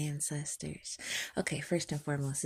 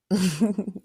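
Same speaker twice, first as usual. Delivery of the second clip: short high laugh; burst of laughter; 5 semitones higher, 9 dB louder than usual. Real, not acted. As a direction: cackle at the joke. Laughs after acting out a talk.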